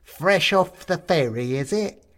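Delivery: slow, loud, high Cockney taunt; Cockney skeleton. A narrator's reading. Skeleton teasing the shrunken newcomer, slow loud Cockney. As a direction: drag it out, a cheeky Cockney skeleton. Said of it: "an incongruously Cockney accent".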